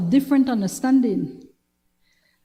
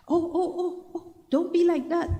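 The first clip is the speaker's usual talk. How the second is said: higher, coaxing mock plea from the guy; mock pleading man; 6 semitones higher, 4 dB quieter, faster than usual. Retelling a man's DM reply, she mocks his higher wheedling plea. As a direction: play him whining to be let off.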